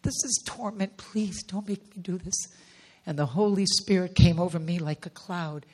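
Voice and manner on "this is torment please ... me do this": softer, hushed pleading, holding back tears; tearful pleading. Retold or imitated. Begging God not to make her do this, tearful and hushed. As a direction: beg quietly, voice close to breaking. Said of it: "fighting back the tears".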